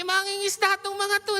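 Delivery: ordinary talk, nothing marked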